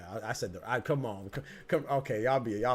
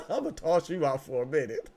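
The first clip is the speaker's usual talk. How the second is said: laughing; 5 semitones higher, 7 dB louder than usual. Real, not acted. Teasing chat member while laughing.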